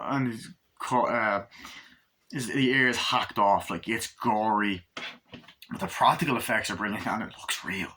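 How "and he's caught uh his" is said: ordinary talk, nothing marked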